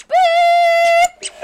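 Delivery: high held beep sound; censor beep. Pretend. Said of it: "We got to beep that out".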